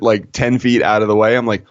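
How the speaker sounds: ordinary talk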